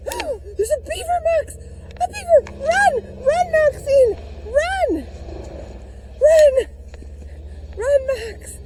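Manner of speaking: high, loud panicked yelling; panicked screaming. Pretend. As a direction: shriek at the dog to flee. Pretend beaver attack, flees.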